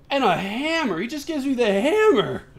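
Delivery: high, swooping excitement; delighted exclamation. Real, not acted. Player finding the hammer reward, delighted exclamation.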